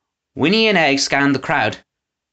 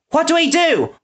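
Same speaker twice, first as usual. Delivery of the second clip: panicked cry. A narrator's reading. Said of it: "Winnie cried".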